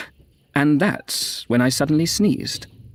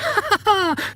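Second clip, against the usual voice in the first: very high cackling laugh; roaring laughter. A narrator's reading. Crowd enjoying the general's humiliation, roaring laughter. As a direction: burst out laughing like a jeering crowd. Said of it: "The voices roared".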